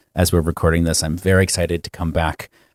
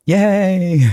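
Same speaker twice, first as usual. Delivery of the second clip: high, bright squeal of delight; excited cheer; 11 semitones higher, 3 dB louder than usual. Real, not acted. Mock cheer at imitation.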